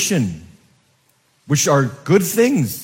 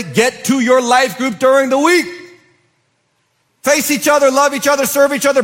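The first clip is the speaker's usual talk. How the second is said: fervent pulpit crescendo; rising preaching fervor; 8 semitones higher than usual. Real, not acted. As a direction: build it up like an exhortation. Preacher builds to a climax.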